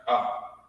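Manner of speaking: a breathed "Ah" to show the sound; letter Ah. Shown as a demonstration. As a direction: say "Ah" as an open, unvoiced ah. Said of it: "this is how it's pronounced".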